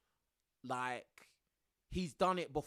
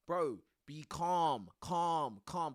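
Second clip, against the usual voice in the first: calm coaching; 2 semitones lower, much slower than usual. Retold or imitated. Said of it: "they're gonna tell you that thing".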